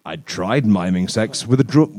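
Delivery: ordinary delivery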